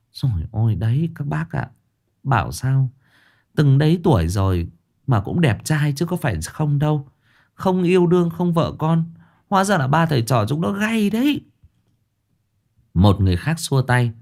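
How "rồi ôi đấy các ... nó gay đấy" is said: much higher gossipy voice; passing women gossiping about the three; gossiping old woman. Acted. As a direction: cluck over the scandal.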